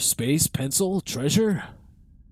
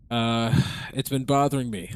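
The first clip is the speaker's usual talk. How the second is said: ordinary talk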